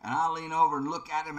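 ordinary talk

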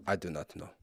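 put-on baffled translator in a Russian accent; mock Russian translator. Translator confused by the letter, Russian accent. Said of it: "the translator would be like this".

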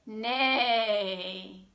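low drawn-out neigh; horse neigh. Shown as a demonstration. Storytime leader showing children what horse says, low imitated neigh. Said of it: "What does the horse say?".

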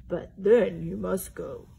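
a touch of sniffles; mildly sniffly bear; sniffling. Acted. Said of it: "he sniffled".

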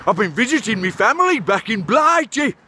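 ordinary talk

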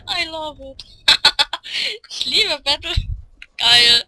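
loud, high yells mixed with laughter; ecstatic yelling. Real, not acted. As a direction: yell and laugh through the brawl. Wild joy in the battle.

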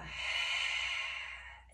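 long voiceless exhale through open mouth; breathy sigh. Shown as a demonstration. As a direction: let the breath out as if fogging a mirror. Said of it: "Exhale with that sigh, fogging up the mirror".

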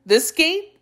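high-pitched, questioning lilt; innocent query. Acted. Jackal asking the tiger about the gate, innocently high. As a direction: ask it high, all feigned innocence.

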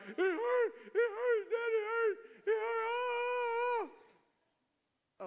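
put-on high, loud whining child; whiny hurt kid. Son to his dad, high loud whining.

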